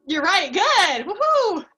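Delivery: loud, high, delighted cheer. Real, not acted. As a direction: cheer it like a win. Delighted at recalling the word.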